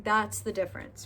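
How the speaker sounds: usual speaking voice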